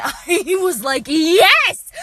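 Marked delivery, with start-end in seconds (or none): 1.1-1.9 loud, high triumphant yell; delighted shout. Retold or imitated. Delighted reaction to the student.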